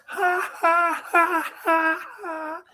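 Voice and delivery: high, cartoonish ha-ha-ha mimicking the guards; mocking guard laughter. Mocking the tower guards who laughed at him, high mock laugh. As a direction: ape the soldiers laughing down from the tower. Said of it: "I hear these guys in the tower laughing".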